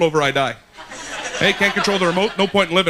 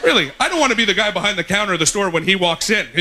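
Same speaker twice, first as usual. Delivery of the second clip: ordinary talk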